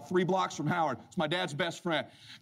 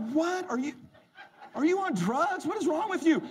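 high, incredulous voice; high incredulous questions; baffled father-in-law; 12 semitones higher than usual. Retold or imitated. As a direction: sound thrown and confused. Howard baffled.

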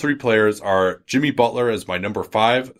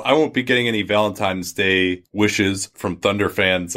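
normal talking voice

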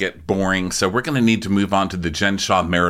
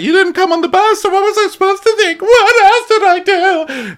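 very high, loud hammed-up wail; mock cry-yelling; 8 dB louder than usual. Mimicking castmate upset about the bus, cry-yelling. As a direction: squeal it, stretching the last question. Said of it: "she's cry yelling".

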